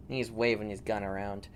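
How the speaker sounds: usual speaking voice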